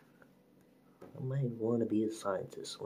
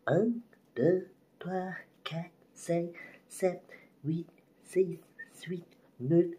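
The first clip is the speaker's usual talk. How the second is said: high counting; 10 semitones higher than usual. Real, not acted. Counting in French.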